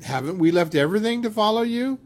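high, quick questioning protest; mildly acted disciple, rising plea; Peter. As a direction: raise the pitch and hurry the question. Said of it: "Peter was the one who said".

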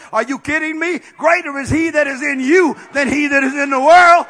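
loud high preacher's cry; full-force preaching. Real, not acted. Preacher rallying the congregation, at full force. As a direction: proclaim it to the saints.

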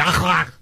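imitated throat-clearing kh sound; mock guttural noise. Co-host mimics over-authentic newsman pronunciation, scraping guttural noise. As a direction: hack from the back of the throat. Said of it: "I don't hear him going".

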